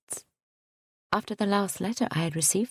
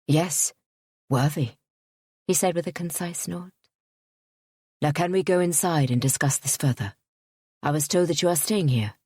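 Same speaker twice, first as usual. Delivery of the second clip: lowered voice for the suitor; a stiff, proud gentleman speaking; lightly voiced haughty suitor. A narrator's reading.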